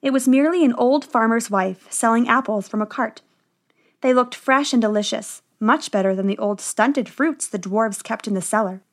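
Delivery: ordinary delivery, nothing marked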